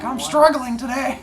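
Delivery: high-pitched mock whine; complaining students. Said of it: "They're like".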